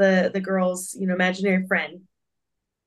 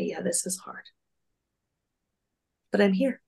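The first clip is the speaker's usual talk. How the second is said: tender; 5 dB quieter than usual. Retold or imitated. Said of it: "sit down right next to us and say".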